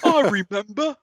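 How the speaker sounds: high, slow Cockney growl; hammy Cockney orc, drawn out. Pretend. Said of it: "It's a terrible Cockney accent".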